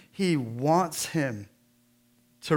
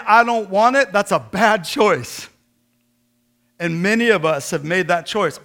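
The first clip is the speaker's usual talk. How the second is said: louder and higher sermon drive, quotes included; full-force preaching; 6 semitones higher, 8 dB louder than usual. Acted. Pastor challenging listeners who refuse grace, preaching hard. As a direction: push it at the congregation.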